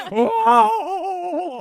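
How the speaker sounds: startled whoa. Pretend. Hotel guest startled by ghost.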